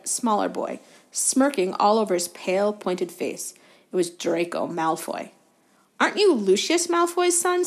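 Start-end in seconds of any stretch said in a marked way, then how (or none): 6.0-7.6 dislike. A narrator's reading. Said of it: "with dislike".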